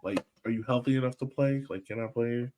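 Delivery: normal talking voice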